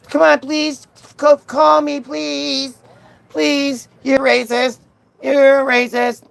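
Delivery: high-pitched pleading turning to mock accusation; mock begging. Streamer mimics heckler begging then accusing, mock begging. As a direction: imitate a needy heckler, overdone. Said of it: "the guy keeps begging".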